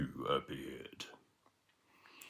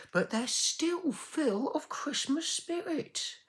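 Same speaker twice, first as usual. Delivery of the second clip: much higher, slow voice; touched miser; slower than usual. Acted. Miser moved by poor family.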